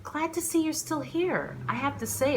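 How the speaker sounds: she tells the waiter she is glad he is still there, delighted. Acted. Greeting old friend waiter.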